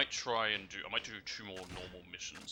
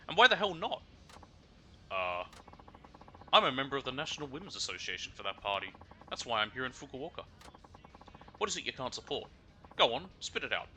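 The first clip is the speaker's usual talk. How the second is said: indignant Kansai lady; 3 semitones higher, 5 dB louder than usual. Acted. Indignant passenger demands answer.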